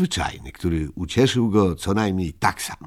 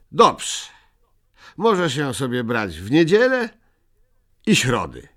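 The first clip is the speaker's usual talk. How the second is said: higher, lightly voiced decision; generous Moomin; 7 semitones higher, 5 dB louder than usual. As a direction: grant it kindly after thinking. Muminek decides, voiced.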